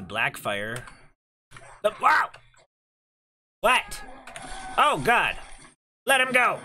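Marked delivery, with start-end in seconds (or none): 1.8-6.6 startled shouting. Real, not acted. Sudden scare in game.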